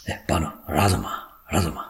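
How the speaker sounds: low, soft consoling; soothing. Acted. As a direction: gently beg her to stop crying. Husband soothes his sobbing wife. (தாழ்ந்த குரலில் ஆறுதல்)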